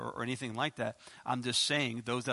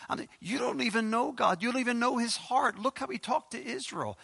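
usual speaking voice

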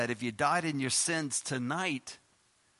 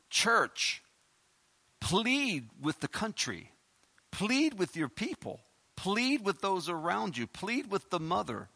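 pleading God; 4 semitones higher, slower than usual. Acted. God's plea voiced to church.

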